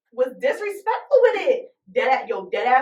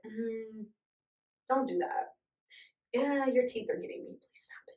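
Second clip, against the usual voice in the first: soft, almost whispered plea; hushed complaint; 5 semitones lower, 14 dB quieter than usual. Retold or imitated. Partner quietly asking to stop in bed. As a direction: murmur it so nobody's embarrassed. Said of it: "somebody was like".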